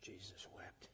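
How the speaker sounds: hushed whisper; whispered climax of the sermon. Acted. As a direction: breathe it out in a whisper. Preacher's emotional climax line.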